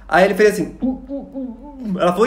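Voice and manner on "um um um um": high, soft, drawn-out grunts; slow high wordless humming grunts; mute man's grunts. Acted. Mute man's wordless reply imitated.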